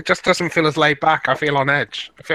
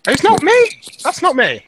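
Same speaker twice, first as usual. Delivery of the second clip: high protest; 5 dB louder than usual. Real, not acted. Insisting the noise isn't his.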